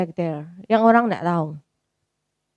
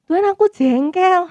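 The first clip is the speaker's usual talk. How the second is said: higher, slow, frank grumble; praying grumble, raised and slow; pouty complaint to God; 9 semitones higher than usual. Retold or imitated. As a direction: confess the annoyance, pouting. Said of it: "aku bilang kayak gitu".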